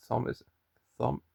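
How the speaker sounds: normal talking voice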